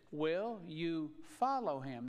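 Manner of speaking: slow down and press the word follow; stress on follow. Shown as a demonstration. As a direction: follow leaned on, slow and drawn out. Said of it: "the emphasis is on follow".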